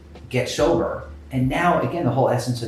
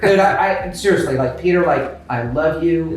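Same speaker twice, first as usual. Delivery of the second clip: laughing; 5 semitones higher, 5 dB louder than usual. Real, not acted.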